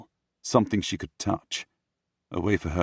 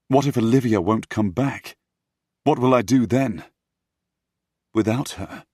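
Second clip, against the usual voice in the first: high, raised, anxious questions; panicked; 5 semitones higher, 7 dB louder than usual. Acted. Prince fearing Olivia won't return, panicked. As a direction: let panic lift the voice.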